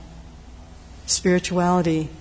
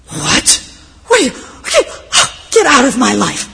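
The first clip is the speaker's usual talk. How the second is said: furious shout; 5 semitones higher, 9 dB louder than usual. Acted. Holy monk explodes at visitor.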